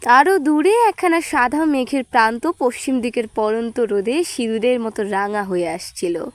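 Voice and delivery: ordinary delivery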